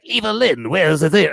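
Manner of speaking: lightly hammed-up villain calling his henchwoman; a touch of theatrical Joker-like villainy; Mark Hamill's Skeletor. Host mimics Skeletor calling Evil-Lyn, villain impression. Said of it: "he's, like, talking like that".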